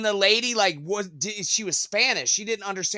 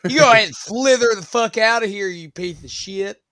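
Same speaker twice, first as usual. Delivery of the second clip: louder, fast mock yell; mock angry banishment; 3 semitones higher, 8 dB louder than usual. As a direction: chase off the snake in a hammed-up rant. Playing Saint Patrick banishing snakes.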